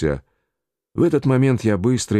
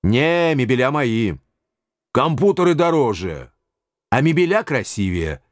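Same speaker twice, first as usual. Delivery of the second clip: higher, insistent haggling; stubborn official; 4 semitones higher than usual. A narrator's reading. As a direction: dig in over the furniture. Officials argue over spoils.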